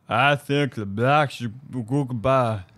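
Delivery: slow put-on foreign voice; mock broken English. Conscripts imitated, slow put-on foreign voice. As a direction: drag it out in a put-on voice. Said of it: "They're like".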